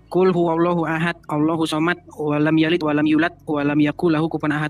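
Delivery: low, plain word-by-word recitation; halting learner's recital, kept low; beginner's Quran reading. Retold or imitated. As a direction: recite it low and unadorned, like a new pupil. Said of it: "saya bacanya begini".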